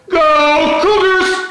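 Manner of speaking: high, drawn-out rallying yell; full-voiced spirited cheer, imitating a superfan; cheerleader yell. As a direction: yell it out long and loud like a pep rally. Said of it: "the one who would yell".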